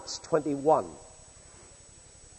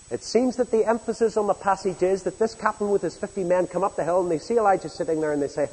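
ordinary delivery

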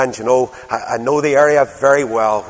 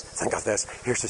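whisper; 4 semitones lower, 14 dB quieter than usual. Shown as a demonstration. Preacher repeats, dropping to whisper.

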